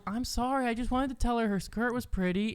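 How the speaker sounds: put-on high, soft apologetic child voice; a small, sorry voice pleading innocence; sad little girl. Niece apologizing to the crying girl's mom, high and soft.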